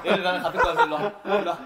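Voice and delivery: loud laughing call. Real, not acted. Playful loud repeat.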